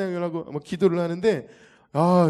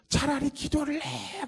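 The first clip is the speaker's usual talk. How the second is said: mocking demon voice; 10 semitones higher, slower than usual. Possessed man taunting.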